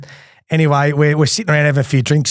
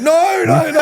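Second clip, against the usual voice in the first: laughing protest; slower than usual. Retold or imitated. Waking up after being choked out.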